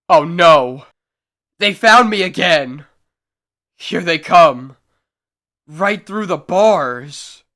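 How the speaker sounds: horror as they come for him; terrified outcry. Acted. Adam Bentley in his cell as the figments return, terrified.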